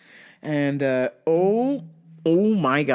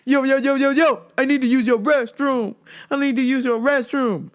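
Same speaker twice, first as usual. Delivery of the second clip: lightly put-on pushy customer, raised pitch; demanding customer; 8 semitones higher than usual. Customer asking for the restroom, imitated demanding voice. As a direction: rattle off the demand in a higher voice.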